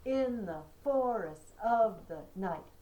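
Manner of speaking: In the forests of the night, slowly, stress on In, for, of and night; slow rhythmic recital; trochaic stress. Shown as a demonstration. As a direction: tap out the beat slowly. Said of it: "opposite of iambic".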